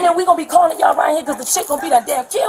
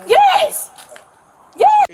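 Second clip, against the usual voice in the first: emphatic yes; 14 semitones higher, 5 dB louder than usual. Real, not acted. Woman answering excitedly.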